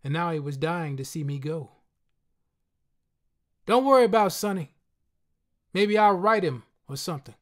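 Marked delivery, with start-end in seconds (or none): 3.6-7.3 higher, louder friend, offhand; friend's parting voice. A narrator's reading. Friend reassuring the narrator at the subway, higher and louder. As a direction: brush it off as the friend.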